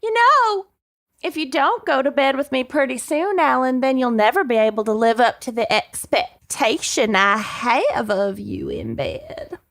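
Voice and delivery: melodramatic Texan church lady, slowing at the end. Acted. Candy pressuring Alan into bed, hammed-up Texan church lady accent. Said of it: "Texan church lady murderer accent".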